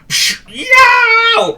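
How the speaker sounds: very high, loud squeal of pain; a pig kicked in the shin squeals. Acted. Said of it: "squealed Charlie".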